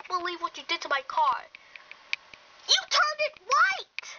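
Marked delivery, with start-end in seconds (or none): 2.7-4.1 high, loud outraged protest; indignant high yell. Acted. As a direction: shout it in disbelief, pitched up high. Quarrel over the car.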